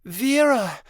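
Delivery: crying out to a lost mother; longing cry. A narrator's reading. Midnight Star calls out to her mother dragon.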